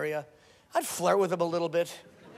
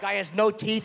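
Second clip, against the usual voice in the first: normal talking voice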